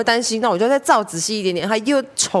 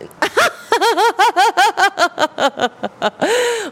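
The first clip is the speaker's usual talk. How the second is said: long high laugh; real laugh; 11 semitones higher than usual. Real, not acted. Teller laughing at the fee, high long laugh. Laughs about paying the clinic.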